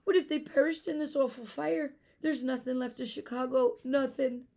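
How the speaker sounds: high, loud despairing sob; a girl sobbing over the burnt city; sobbing harder. Acted. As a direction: cry it out in despair. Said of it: "Eilish sobbed even harder".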